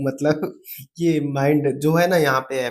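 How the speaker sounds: normal talking voice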